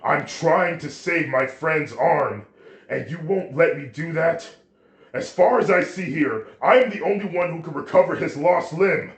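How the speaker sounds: loud, high-pitched outraged shouting; furious yell. A narrator's reading. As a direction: yell it out in fury. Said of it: "and he yells out".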